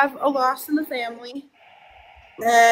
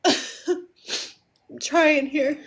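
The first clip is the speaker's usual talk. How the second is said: tearful laugh; 2 semitones higher, 4 dB quieter, much slower than usual. Real, not acted. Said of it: "Just take a breath".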